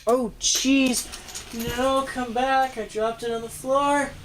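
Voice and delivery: high-pitched cry of dismay; dismayed outcry. Real, not acted. Toy reviewer drops a piece mid-demo, high dismayed outcry. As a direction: yelp as the thing gets away from you.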